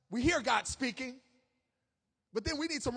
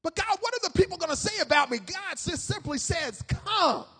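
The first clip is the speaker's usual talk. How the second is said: high, raised voice of pleading and command; forceful, high pulpit delivery; loud preached exchange; 5 semitones higher, 5 dB louder than usual. Acted. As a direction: call it out like a preacher acting both sides. Acted exchange of believer and God.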